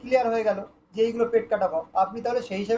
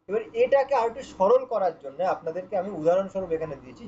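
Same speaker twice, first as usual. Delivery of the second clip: normal talking voice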